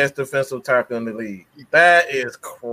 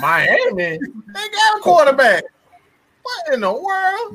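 much higher and slow, in disbelief; incredulous; 14 semitones higher, slower than usual. Real, not acted. Teammate balks at Miami needing a quarterback, high and slow in disbelief. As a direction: stretch it out, can't believe it.

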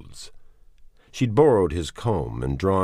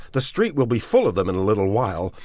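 urgent warning; 6 semitones higher, 5 dB louder, faster than usual. A narrator's reading.

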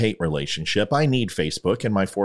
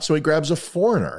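ordinary talk

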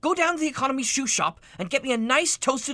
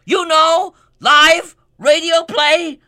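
louder and slower, stressing each word; loud, slow spelling-out; 6 dB louder, slower than usual. Acted. Boss shouting slowly at a waiter who doesn't understand. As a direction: hammer it home as if to a child.

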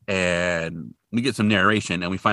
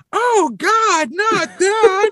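very high, loud imitated shriek; horrified mother; 8 dB louder, much slower than usual. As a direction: screech in hammed-up horror down the phone. Horrified mother over the phone.

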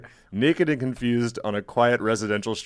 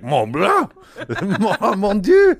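put-on French accent; 8 semitones higher, 4 dB louder, slower than usual. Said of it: "and they're like".

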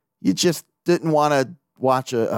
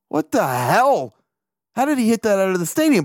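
retold astonishment; 7 semitones higher than usual. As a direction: replay being stunned by what you saw. Retelling his shock at the replay.

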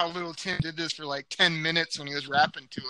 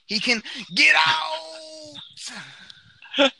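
shouting; 13 semitones higher, 5 dB louder than usual. Acted. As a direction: bellow the catchphrase. Get out catchphrase shout.

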